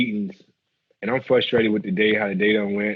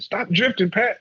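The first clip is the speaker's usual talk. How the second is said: coach's remark; 8 semitones higher, slower than usual. Retold or imitated. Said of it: "'cause Greg said".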